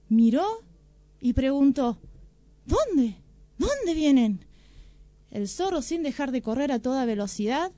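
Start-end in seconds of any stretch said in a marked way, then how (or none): 2.7-4.4 startled. Acted. Startled tiger asks where.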